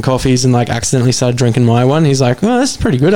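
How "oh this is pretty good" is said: high-pitched grudging discovery, retold; pleasantly surprised. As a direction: act out being won over by a sip. Retold surprise at tasting the mocha.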